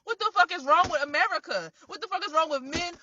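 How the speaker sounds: normal talking voice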